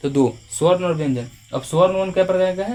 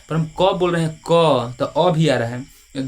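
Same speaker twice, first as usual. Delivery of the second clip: slow demo; slower than usual. Shown as a demonstration. Teacher to students, slowly sounding out क. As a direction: stretch the words so the vowel is heard. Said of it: "ध्यान से सुनिएगा".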